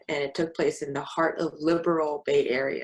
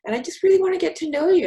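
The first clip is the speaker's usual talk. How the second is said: high, fast, sugary mock of a nice lady; imitating the landlady; 13 semitones higher, 7 dB louder, much faster than usual. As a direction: chirp it like an overly friendly host.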